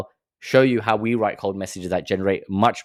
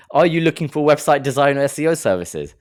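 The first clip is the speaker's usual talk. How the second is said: mock sales pitch; 5 semitones higher, 4 dB louder than usual. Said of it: "he goes straight in again".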